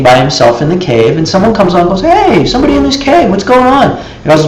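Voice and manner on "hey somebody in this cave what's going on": high, lively voice of a curious passer-by; nosy visitor. Retold or imitated. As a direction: call into the cave, nosy and much higher. Visitor calls into meditator's cave.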